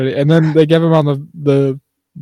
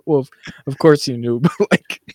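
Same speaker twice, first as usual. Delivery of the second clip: ordinary talk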